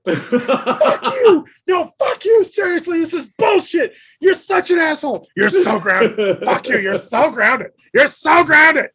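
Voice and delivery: high, loud shrieking rant, half laughing; mock furious yelling. Friend yelling at him over a book spoiler, shrieking high and loud. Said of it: "spent about an hour one night yelling at me".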